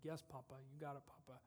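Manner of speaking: normal talking voice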